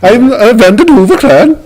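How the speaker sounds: put-on a touch of a Dutch accent. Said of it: "that is way too good of a Dutch accent".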